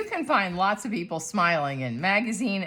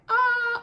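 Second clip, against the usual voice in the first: high, open "aah"; wide-open-mouth "ah"; 3 dB louder than usual. Shown as a demonstration. Art teacher showing a wide mouth, high open "ah". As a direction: drop the jaw wide on a high "ah". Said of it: "If your mouth opens wide this way".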